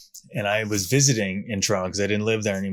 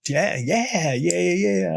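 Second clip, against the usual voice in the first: high, excited string of yeahs; gleeful agreeing; 9 semitones higher, slower than usual. Retold or imitated. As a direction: nod along, thrilled. Retold gleeful agreement at meeting.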